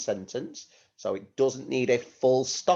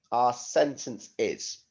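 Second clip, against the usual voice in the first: normal talking voice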